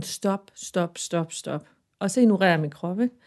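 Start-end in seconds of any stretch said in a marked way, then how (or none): 0.0-1.7 body saying stop. Retold or imitated. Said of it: "min krop siger bare sådan".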